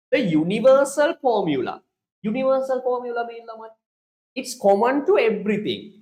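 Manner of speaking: teacher emphasis on universal; emphatic lecture. Shown as a demonstration. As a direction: drive home "universal formula" to the class. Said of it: "remember the word".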